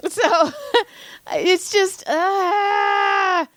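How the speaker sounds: high laughing squeal while venting. Real, not acted. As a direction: break into a high helpless laugh.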